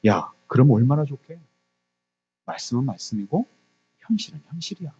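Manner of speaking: hushed, dismissive tempter; devil's whispering temptation. Acted. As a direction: drop to a soft, insinuating murmur. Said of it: "사단 마귀가 이렇게 속삭이는 거예요". (속삭이듯 나직하게 꾀는 말투)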